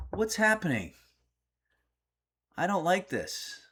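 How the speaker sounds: high, uneasy voice; mildly freaked out. Real, not acted. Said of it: "being kind of freaked out".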